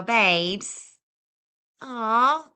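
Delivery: ordinary talk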